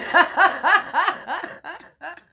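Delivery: loud high burst of laughter; big laugh. Real, not acted. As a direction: laugh at yourself. Laughs after own mock outcry.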